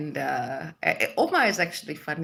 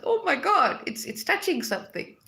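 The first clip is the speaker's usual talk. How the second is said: high-pitched gush of recognition; overcome, voice leaping up; moved exclamation; 12 semitones higher than usual. Retold or imitated. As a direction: exclaim it high, as if suddenly touched. Voicing an overwhelmed reaction to hearing Tamil.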